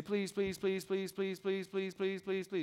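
high, rapid-fire pleading on one note; begging small child. Acted. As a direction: pester like a little kid begging. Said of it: "whenever your children are small".

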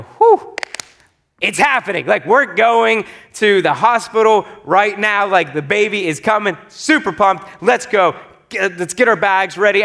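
pumped and eager, rushing to go; giddy thrill, ready to leave now; excited. Retold or imitated. Husband to himself as labor starts, excited.

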